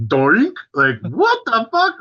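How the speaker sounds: high, drawn-out incredulous cry; stunned disbelief. Retold or imitated. Seeing wooden flutes instead of vocals, high stunned disbelief. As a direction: play it as total shock, slowed down.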